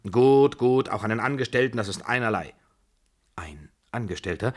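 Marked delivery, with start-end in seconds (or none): 0.1-2.5 loud, high burst, then easing; imperious, hurried assent; impatient agreement. A narrator's reading. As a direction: cut in with brusque consent.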